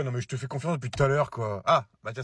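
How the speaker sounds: normal talking voice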